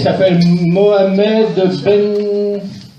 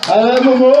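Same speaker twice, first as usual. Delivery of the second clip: calling out; 5 semitones higher than usual. Real, not acted. Calling audience member up.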